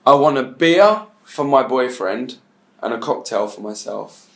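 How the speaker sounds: a measured, deliberate ask. Shown as a demonstration. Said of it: "I want you to say two things in your language".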